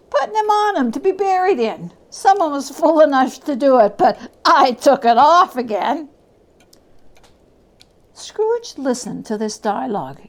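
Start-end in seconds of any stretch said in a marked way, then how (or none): 0.1-6.1 louder and higher, cackling defiance; a callous thief laughing it off; loud mocking old woman; brazen charwoman. Charwoman explains cruelly.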